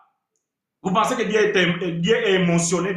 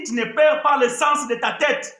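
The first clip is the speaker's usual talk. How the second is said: ordinary talk, nothing marked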